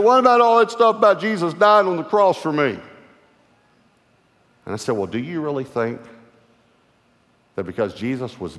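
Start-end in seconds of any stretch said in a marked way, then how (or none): 0.0-2.8 higher and louder, pressing; man pleading with the preacher, urgent; urgent plea. Retold or imitated. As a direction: beg for the promise back.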